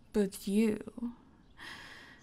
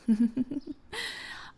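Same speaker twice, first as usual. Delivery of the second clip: a light, amused laugh; teasing chuckle; 4 semitones higher than usual. Acted. Chuckling at the naughty little bird. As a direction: chuckle at them.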